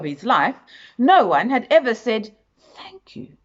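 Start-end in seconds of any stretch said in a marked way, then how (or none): 2.7-3.4 softer, higher, gentle thanks; soft thank you. A narrator's reading. Mister Good marvels that someone thanked him, echoed softly. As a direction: echo her soft gratitude.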